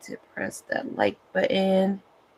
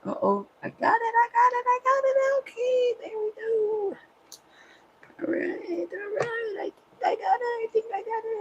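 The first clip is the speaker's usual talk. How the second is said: squeaky high, wired chatter; buzzing on coffee, much higher; too much caffeine; 4 dB louder, faster than usual. Real, not acted. The coffee has kicked in.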